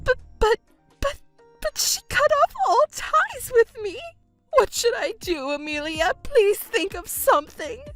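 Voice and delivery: high, stammering distress; panicked pleading. Acted. Mother begging Amelia for help after being cut off, panicked. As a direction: wail and beg for help.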